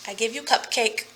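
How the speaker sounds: usual speaking voice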